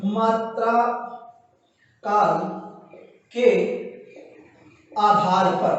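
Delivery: slow, word by word, as if writing it on the board; slow dictation. Shown as a demonstration. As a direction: spell it out slowly for students to copy. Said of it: "मैं यहाँ लिख देता हूँ".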